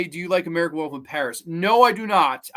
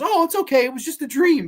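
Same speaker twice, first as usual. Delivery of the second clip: high-pitched, louder mock nurse voice; nurse in a film; 10 semitones higher, 4 dB louder than usual. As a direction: play the reassuring nurse, pitched way up. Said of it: "then the nurse goes".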